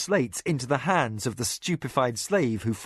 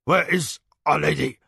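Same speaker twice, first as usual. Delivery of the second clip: sharp demand; 2 semitones higher, 4 dB louder than usual. A narrator's reading. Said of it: "demanded sharply now, and taut with worry".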